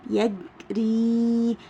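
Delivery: يجري in two slow parts, long ee at the end; teacher's stretched-out يجري; elongated final vowel. Shown as a demonstration. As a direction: sound out يجري, holding the final ee. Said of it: "صوته بيكون طويل".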